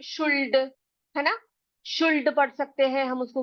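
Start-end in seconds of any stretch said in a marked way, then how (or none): 0.0-0.7 शुल्ड with the l sounded, "shoold"; spelling pronunciation. Shown as a demonstration. Teacher reading should as it looks, spelling pronunciation. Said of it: "ऐसे देखेंगे तो हम पढ़ेंगे". 1.9-2.3 शुल्ड with an audible l; "shoold", l voiced in शुल्ड; spelling pronunciation. Shown as a demonstration. Teacher voicing the pupil's misreading, spelling pronunciation. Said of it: "ए एल दिया हुआ है".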